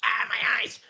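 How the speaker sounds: high-pitched, hammed-up yelp; mock pained cry. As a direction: yelp as if blinded, played for laughs. Reacts to a glaring image.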